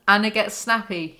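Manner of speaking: ordinary talk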